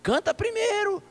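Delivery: put-on very high, insistent old-man voice; velho pastor. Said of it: "Ele disse assim". (imitando o velhinho, agudo e insistente)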